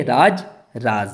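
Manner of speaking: slow राज with j, then राज with z; nukta contrast. Shown as a demonstration. Teacher models राज and राज for students to repeat. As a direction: stretch out राज, j first, then z. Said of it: "अब आप बोलिए".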